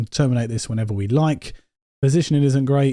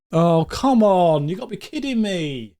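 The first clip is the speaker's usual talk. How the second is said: exasperated groan; 5 semitones higher than usual. Real, not acted. Frustrated by another injury.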